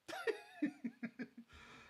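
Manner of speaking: a very high, squealing laugh; high laughter. Real, not acted. Laughing at own story.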